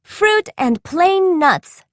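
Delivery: high, slow excited cry; cried out. A narrator's reading. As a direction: call the answer out gleefully. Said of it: "cried Elena and Naya".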